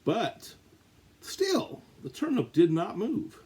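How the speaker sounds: sudden high-pitched but still; dramatic high exclaim. A narrator's reading. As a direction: leap way up to sell the surprise. Suspense beat in read-aloud story.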